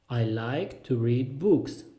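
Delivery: slow, spaced-out words; slow repeat. Shown as a demonstration. Teacher repeats the reading sentence for learners, slowly. Phrase drill, slower repeat.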